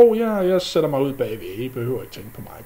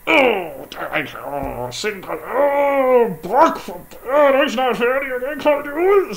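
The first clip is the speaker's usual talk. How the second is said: high, loud cries of pain through the words; pained wailing; 11 semitones higher, 6 dB louder than usual. Acted. Sindre crying out to Brok as the fly bites. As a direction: wail like a fly is stinging your forehead.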